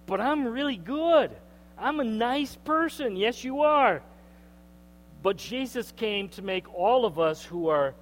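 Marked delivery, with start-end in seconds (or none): 0.0-3.2 self-assured protest. Retold or imitated. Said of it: "people around you who say".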